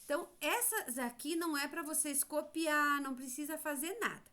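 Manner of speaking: ordinary talk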